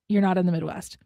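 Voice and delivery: ordinary talk, nothing marked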